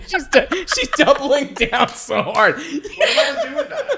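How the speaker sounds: laughing through the words, voice jumping up high; cracking up in disbelief as she talks. Real, not acted. Host cracking up at guest doubling down, laughing.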